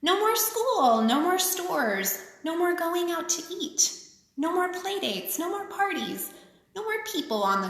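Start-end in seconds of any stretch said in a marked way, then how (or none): none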